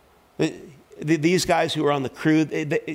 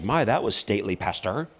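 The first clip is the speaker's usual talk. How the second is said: dry sarcasm; 6 semitones lower than usual. Retold or imitated. Wife to pastor after he hangs up, dryly sarcastic. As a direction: imitate a wife's understated reproach. Wife's dry remark, retold.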